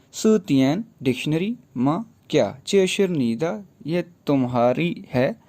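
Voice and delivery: ordinary delivery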